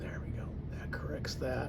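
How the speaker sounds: whispering to himself; hushed unvoiced murmur. Real, not acted. Whispers while painting.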